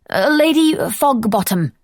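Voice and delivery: high, slow, blurted fake English accent. Lady blurts a fake name at the butler in a bad English accent. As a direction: stumble into a made-up posh name.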